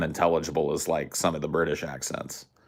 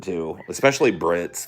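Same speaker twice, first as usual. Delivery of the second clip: ordinary delivery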